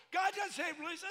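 high, loud retold protest. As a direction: protest sharply as the contractor. Contractor's retold objection.

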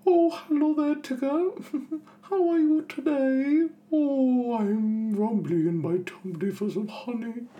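very high, slow, dreamy cartoon bear; sleepy, sweet little bear talking slowly; Winnie the Pooh voice. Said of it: "the notorious Winnie the Pooh".

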